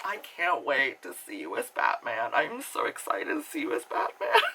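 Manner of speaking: hushed gushing, climbing into squeaky pitch; quietly hammed-up fan excitement; mock excited fan. Mocking fan reply to Pattinson.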